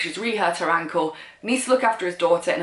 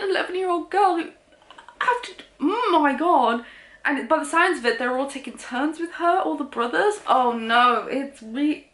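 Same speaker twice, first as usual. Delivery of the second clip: appalled disbelief; 7 semitones higher than usual. Real, not acted. Appalled reader reacting to the book.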